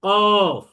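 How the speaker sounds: কফ loud, heavy, rounded lips; গোল মুখে জোরে কফ; round heavy qaf. Shown as a demonstration. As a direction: say কফ with a round mouth from the back of the tongue. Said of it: "মুখ গোল করে বলতে হবে".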